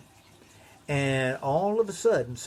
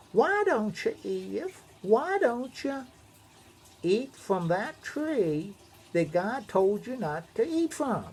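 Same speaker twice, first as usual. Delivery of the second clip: sly snake voice; 10 semitones higher than usual. Acted. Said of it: "Snake says".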